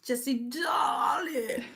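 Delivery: high, long-drawn playful call; hammed-up affectionate greeting; theatrical darling greeting. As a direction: stretch out "darling" extravagantly.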